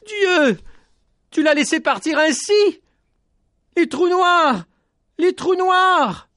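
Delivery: high, loud anguished cry; crying out in pain. Acted. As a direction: hurl the grief at God. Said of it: "Petit Jean criait sa douleur". (cri de douleur aigu et fort)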